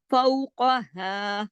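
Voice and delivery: फौकहा with हा held long; steady sustained हा ending फौकहा; lengthened ending. Shown as a demonstration. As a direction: recite slowly, stretching the final vowel of फौकहा. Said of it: "हा को खींचेंगे".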